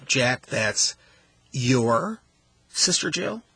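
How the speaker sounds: "your" punched and rising, set off by pauses; stress on YOUR. Shown as a demonstration. Host showing how emphasis changes meaning, stress on "your". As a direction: lean hard on "your", questioning. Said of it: "by the way that we emphasize".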